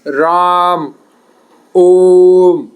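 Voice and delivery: a drawn-out call of "ओम" across a distance; calling out, vowel stretched. Shown as a demonstration. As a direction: call out "राम", then stretch "ओम" long and loud. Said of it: "इसका प्रयोग किसी को पुकारने में किया जाता है".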